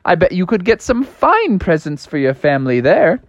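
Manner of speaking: high, cheerful rabbit gentleman; bunny gentleman voice. Acted. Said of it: "the bunny rabbit gentleman said".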